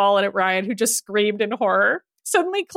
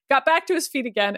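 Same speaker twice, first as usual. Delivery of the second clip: ordinary delivery, nothing marked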